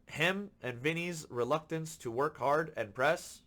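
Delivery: ordinary talk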